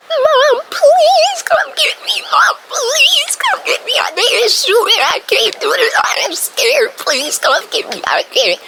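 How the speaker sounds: high, loud, desperate pleading to mom; reenacting a terrified call to mom, high and loud; panicked begging. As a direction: beg mom to come get you, near tears. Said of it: "I'm about to reenact it".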